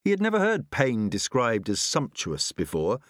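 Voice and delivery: plain reading voice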